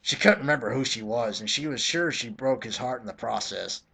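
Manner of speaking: ordinary delivery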